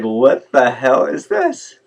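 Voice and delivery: slow, emphatic bafflement at what just happened; incredulous. Retold or imitated. Incredulous reaction to an abrupt approach.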